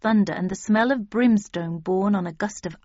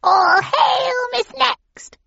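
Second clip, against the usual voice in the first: very high, loud hag shriek; shrieking witch; 7 dB louder, much slower than usual. A narrator's reading. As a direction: shriek it like a left-out crone. Third witch joins shouting.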